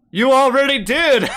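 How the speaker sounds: high, loud, laughing jab; laughing taunt. Real, not acted. Reactor laughing at the speaker's joke setup, loud mocking laugh.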